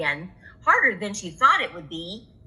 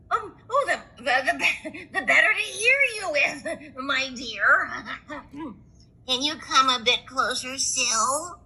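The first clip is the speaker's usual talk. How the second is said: higher put-on granny with chuckles; wolf barely hiding glee; wolf as grandma; 4 semitones higher than usual. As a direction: sly fake grandmother.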